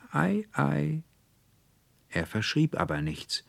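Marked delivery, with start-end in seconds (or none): 0.1-1.0 much higher, a light amused "Ei, ei"; a doctor chuckling it off; smiling doctor. Acted. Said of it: "Der lächelte und sagte wieder".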